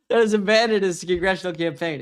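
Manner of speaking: laughing while talking; real laughter. Real, not acted. Streamer mocking a failed congressional campaign, laughing as he talks. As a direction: crack up, then push the words out through the laugh.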